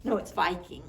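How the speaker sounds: plain reading voice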